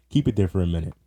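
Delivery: normal talking voice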